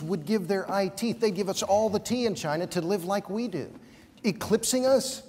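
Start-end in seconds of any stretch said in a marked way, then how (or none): 4.2-5.2 incredulous echo. Real, not acted. Debater scoffing at his opponent's claim, incredulous. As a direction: toss the words back in disbelief. I can't even begin here.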